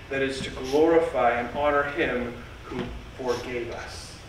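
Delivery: ordinary talk, nothing marked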